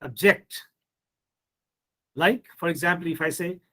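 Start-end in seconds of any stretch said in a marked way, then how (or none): 0.0-0.6 "object" stressed on JECT, as a verb; light start, then hit JECT in "object"; second-syllable stress. Shown as a demonstration. Teacher showing students the verb, "object" stressed on JECT. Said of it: "As verb".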